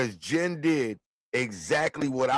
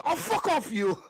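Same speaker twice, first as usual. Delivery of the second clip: high mimicked comic curse; Robin Williams; 9 semitones higher than usual. Recalling a comedian's live routine, high-pitched imitation. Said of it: "Robin Williams, live in the".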